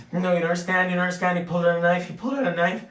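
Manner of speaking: high, very loud frantic screaming; hysterical. Acted. Woman screaming to the cop about a knife, hysterical. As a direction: scream it in breathless panic, words tumbling out. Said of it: "She's going totally hysterical".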